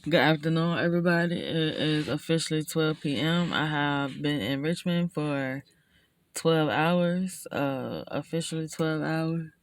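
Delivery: worn out, low and quiet; drained after a long drive; tired. Real, not acted. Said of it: "Oh, I'm tired".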